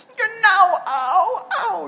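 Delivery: put-on loud, high child's wail.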